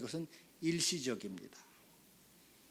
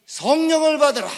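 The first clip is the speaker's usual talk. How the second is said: high, very loud divine command; commanding proclamation; 13 semitones higher, 17 dB louder than usual. Acted. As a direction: proclaim it with full pulpit force. Lord's command voiced in sermon.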